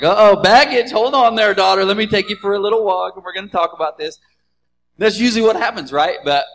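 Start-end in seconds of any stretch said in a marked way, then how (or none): 0.0-4.2 protective mom. Retold or imitated. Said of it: "they're like".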